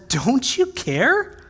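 high, slow, aggrieved question; pleading complaint. Retold or imitated. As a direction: draw it out, hurt and put out. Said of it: "She says".

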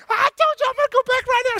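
high, fast mocking voice; mock shrill mimicry. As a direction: parrot her back in a high squeal. Joseph mimics Mary mockingly.

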